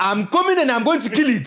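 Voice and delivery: put-on very high, loud Nigerian accent; triumphant hammed-up Nigerian boast. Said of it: "bring that Nigerian accent".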